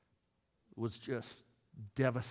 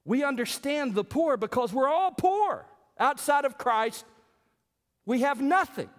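preaching at full force; 10 semitones higher, 5 dB louder than usual. Acted. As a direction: drive the point home from the pulpit. Preaching climax over music.

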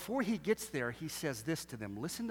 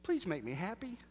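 a hint of a fed-up parent pleading; lightly voiced fed-up parent begging; exasperated plea; 10 semitones higher than usual. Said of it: "he might have said it like this".

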